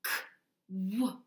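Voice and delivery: "k" then "w" as two separate sounds; split k and w. Shown as a demonstration. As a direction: pull the two sounds apart. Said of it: "that first sound was".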